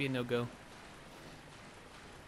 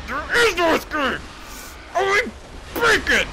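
much higher, loud villain shout; mock menacing yell; hammed-up threat; 11 dB louder than usual. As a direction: declare doom like a cartoon villain. Player threatens pig.

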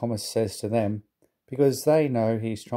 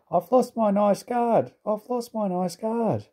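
much higher, slow mock sobbing; 10 semitones higher than usual. A narrator's reading. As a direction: wail it like a tearful coach. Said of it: "she sobbed".

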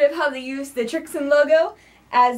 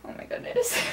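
whispered; 9 dB quieter than usual. Real, not acted. Flustered after botched intro.

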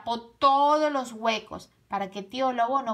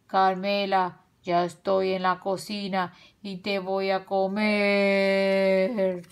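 slow, stretched-out spooky threat; menacing wolf; 2 semitones lower, slower than usual. A narrator's reading. Tío Lobo threatening from the kitchen, slow menacing voice. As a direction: creep closer with each slow word.

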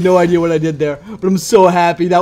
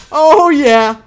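1 gloating cheer; 9 semitones higher, 9 dB louder than usual. Real, not acted. Gloating after the kill.

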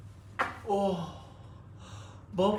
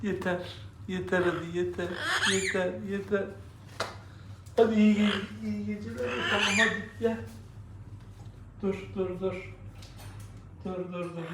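soft soothing; 8 dB quieter, faster than usual. Real, not acted. Settling the baby down to sleep.

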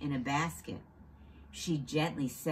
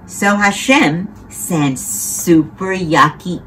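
much louder storytelling; dramatic announcement; 15 dB louder than usual. A narrator's reading. Narrator announcing the plagues to children, loud. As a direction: boom out the plagues.

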